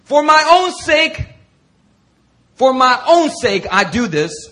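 preaching scripture. Acted. Preacher reading Isaiah to the church, slow and loud. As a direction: declaim God's words.

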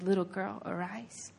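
low, very soft call; hushed, tenderly acted invitation; tender, close. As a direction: murmur it gently to someone right beside you. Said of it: "He brought himself near to her".

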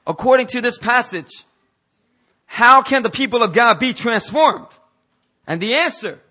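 high, loud rhetorical question; full-force preaching. Real, not acted. Preacher asking how God's people change, full-force preaching. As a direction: throw the question out to the whole room.

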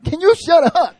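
high, loud, fed-up snap; exasperated shout. Retold or imitated. Pastor to Tyler in the meeting, exasperated shout. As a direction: hiss it out loud at the friend who won't stop. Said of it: "I'm like".

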